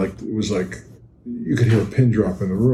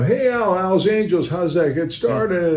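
much higher, mock-curious asking voice; mimicking curious people; 8 semitones higher than usual. People asking Al how it started, high mock-curious voice. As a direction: play the eager questioner. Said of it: "people ask me all the time".

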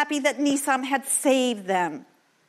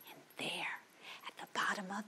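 hushed reveal; whisper; 2 semitones lower, 16 dB quieter, faster than usual. A narrator's reading. Narrator hushing before the treasure reveal. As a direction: lean in and whisper the secret. Discovering hidden chest.

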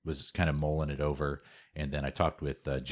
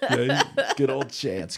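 higher and louder through a laugh; 8 semitones higher, 5 dB louder, slower than usual. Real, not acted. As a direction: laugh his name out fondly. Laughing about a training partner.

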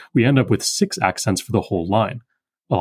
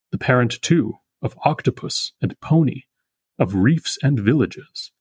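slow, measured recital of the verse; poem reading. Acted. Lecturer rereading the poem for the class, slow and measured. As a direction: read it with the weight of the meter.

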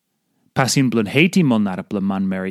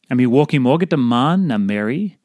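normal talking voice

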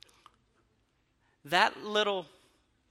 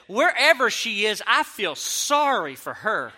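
loud, higher, drawn-out imitated scorn; mock scornful outburst; 5 semitones higher, 7 dB louder than usual. Angry mother insulting him about his wife, loud mock scorn. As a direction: imitate an irate mother, leaning hard on it.

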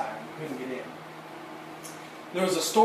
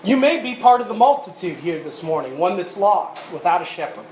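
louder, higher full-force sermon delivery; preaching; 5 semitones higher, 7 dB louder than usual. Real, not acted. Preacher pleading with the congregation, preaching at full force. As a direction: drive the message home to the congregation.

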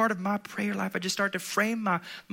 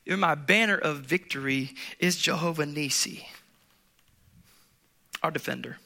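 ordinary talk, nothing marked

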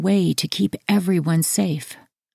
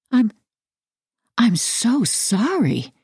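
halting, slow apology with a higher pitch; stammered apology; 6 semitones higher, slower than usual. A narrator's reading. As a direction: falter after the first word, then apologize slowly. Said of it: "I stammered".

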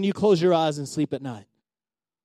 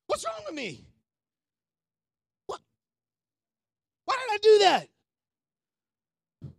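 higher, mock exasperated self-questioning, softer; self-reproach imitation; 11 semitones higher, 5 dB quieter, faster than usual. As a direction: scold yourself in a mocking high voice. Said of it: "I have those moments all the time".

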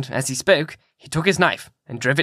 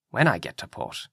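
softer, lower repeated phrase; a softer, lower echo of the words; quiet echo; 4 semitones lower, 6 dB quieter than usual. A narrator's reading. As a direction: murmur it back to yourself. Captain echoes his own words.

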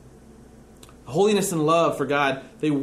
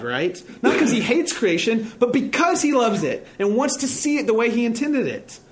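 ordinary talk, nothing marked

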